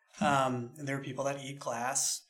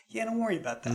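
dismissive brush-off; 7 semitones higher, much faster than usual. Retold or imitated. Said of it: "the best answer I get was".

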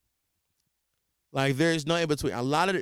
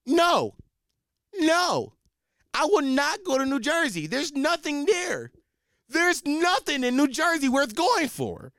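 squeaky high, slow, stubborn no; high mock refusal; 10 semitones higher, slower than usual. Real, not acted. Refusing the Jersey trip, squeaky high and slow. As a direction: whine it up high, refusing to budge.

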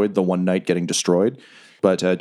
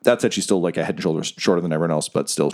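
normal talking voice

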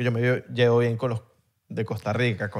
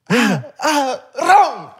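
señalando desesperado; 9 semitones higher, much slower than usual. Retold or imitated. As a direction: point and yell the word, much higher. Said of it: "Y yo señalaba". (pidiendo ron a gritos, muy agudo)

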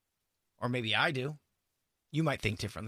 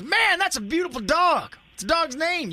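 gushing guy at the dog park; 12 semitones higher, 5 dB louder than usual. Retold or imitated. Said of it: "some guy comes up to you and goes".

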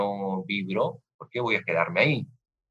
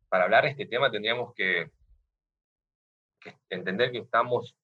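ordinary talk, nothing marked

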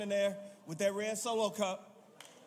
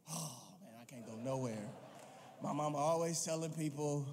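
low, much softer put-on voice of an exasperated Jesus; Jesus grumbling; 5 semitones lower, 4 dB quieter than usual. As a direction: grumble it low and soft like a son embarrassed by his mother. Jesus complains about mama in story.

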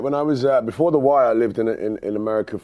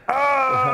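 mock shocked cry; 3 dB louder than usual. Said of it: "I was like".

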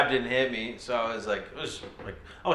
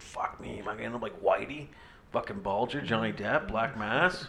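panicked whisper; 8 dB quieter than usual. Retold or imitated. Retold panicked inner thought.

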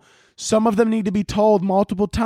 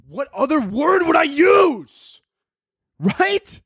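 high, drawn-out incredulous yell; outraged shout; 9 semitones higher, slower than usual. Acted. Comic mocking an article's advice, outraged shouting. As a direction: stretch the question into a shrieked protest.

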